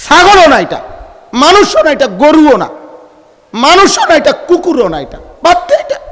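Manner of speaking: high, loud exclamations of rebuke; scornful preaching. Real, not acted. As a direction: cry out each dismissal from high in the voice. (তাচ্ছিল্যভরা উঁচু গলার ওয়াজ)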